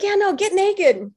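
insistent inner voice. Retold or imitated. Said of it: "it was like".

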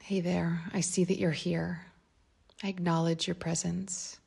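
ordinary talk

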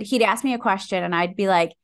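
ordinary talk

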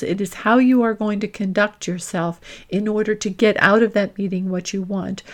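normal talking voice

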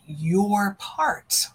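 usual speaking voice

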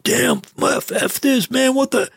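high-pitched imitation of a cussing tantrum; mock angry outburst. Doctor mocking surgeons who cuss over missing equipment, mock tantrum. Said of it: "start yelling and dropping F-bombs".

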